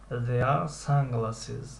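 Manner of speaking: very drawn-out answer; slow model answer. Shown as a demonstration. Teacher drills the answer slowly for students. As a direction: stretch every word for learners. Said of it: "teacher models answer".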